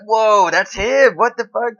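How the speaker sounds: retelling his own shock in a big, slow, stunned voice; mock astonishment. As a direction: act floored by the realisation. Retold shock at the discovery.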